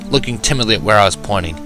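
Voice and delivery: plain reading voice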